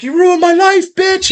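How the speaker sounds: high-pitched screaming comedian impression; mock enraged shriek; Sam Kinison. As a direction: scream it as an accusation, imitating a ranting comic. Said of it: "And he's screaming at her".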